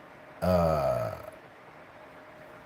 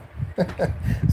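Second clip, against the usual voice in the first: breathy laugh; 10 semitones higher than usual. Real, not acted. Laughing at the memory.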